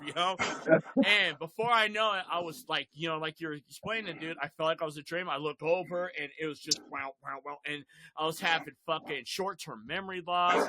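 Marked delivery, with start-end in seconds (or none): none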